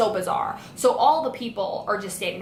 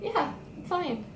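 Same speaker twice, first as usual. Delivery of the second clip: dazed answer; 7 semitones higher, 7 dB quieter than usual. Retold or imitated. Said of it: "and I'm like".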